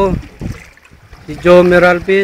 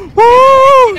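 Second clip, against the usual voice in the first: excited cheer; 4 dB louder than usual. Real, not acted. Cheering at blue Red Sea.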